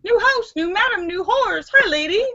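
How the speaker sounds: high, loud squawking parrot. Acted. The brothel parrot greeting the new owner, high squawk. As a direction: screech it like a cheeky parrot. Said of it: "the parrot says".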